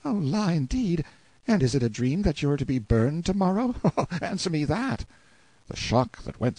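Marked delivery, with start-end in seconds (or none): 0.0-5.0 high-pitched page boy, mocking playfully; light-hearted teasing boy. A narrator's reading. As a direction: tease with a light little laugh, voice pitched up. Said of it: "he only laughed in his light-hearted way".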